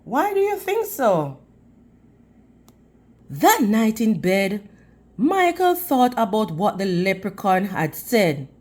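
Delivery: ordinary delivery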